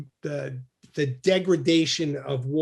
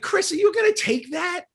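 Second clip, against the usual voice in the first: mock egging-on; 12 semitones higher than usual. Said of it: "I'm like".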